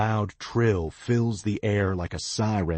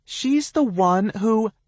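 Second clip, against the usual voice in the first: high, loud nasal bray; whiny bray; 7 dB louder than usual. A narrator's reading. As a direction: whine it out like a bray. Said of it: "in a whiny bray".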